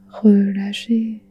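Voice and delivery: ordinary talk, nothing marked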